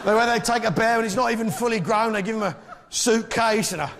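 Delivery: high-pitched, worked-up comic indignation; mock outrage. Comedian ranting to the audience about Peru's bears, mock-outraged.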